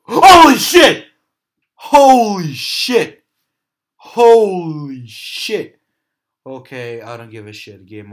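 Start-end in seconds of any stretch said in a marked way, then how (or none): 0.1-5.8 slow, loud terrified screaming; jump scare laugh. Real, not acted. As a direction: drag out the scream in terror.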